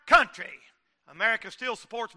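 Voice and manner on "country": drawn-out loud sermon climax; slow it down and lean on every word; slow emphatic preaching. Acted. Preacher warning the ladies and gentlemen, slow and loud.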